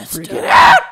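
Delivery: sudden high shout; over-the-top yelled freak-out; mock freak-out. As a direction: explode into a loud, high panic. Same words, now as an outburst.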